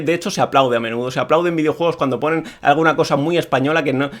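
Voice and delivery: ordinary talk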